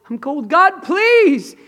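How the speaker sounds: high, pleading cry. Retold or imitated. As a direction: break into a high, desperate plea. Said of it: "And I said".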